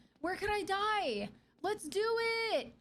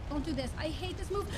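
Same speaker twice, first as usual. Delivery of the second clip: ordinary talk, nothing marked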